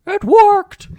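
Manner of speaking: loud, very high-pitched exclamation; Emmett Brown. Acted. Quoting the movie's inventor to co-host, wildly triumphant shout. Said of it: "Emmett Brown is like".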